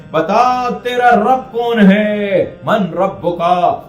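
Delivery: slow, loud, high booming interrogation; thunderous angel voice. Acted. The grave's angels demand of the dead man who his Lord is, slow booming thunderous voice. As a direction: boom out the question like a stern angel of the grave. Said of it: "وہ ایسے گرجدار آواز میں".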